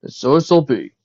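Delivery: loud, theatrical proclamation; dramatic declaration. A narrator's reading. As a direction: proclaim it like a grand captain. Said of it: "declared to the committee dramatically".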